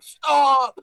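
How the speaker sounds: loud, high shout. Retold or imitated. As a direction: yell it to make them stop. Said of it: "and they were like".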